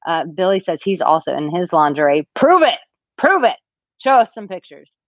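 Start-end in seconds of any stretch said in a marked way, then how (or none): 2.4-5.0 higher, louder playful dare; teasing challenge. Real, not acted. Host teasing a commenter to prove his claim, playful dare. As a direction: egg him on, playful.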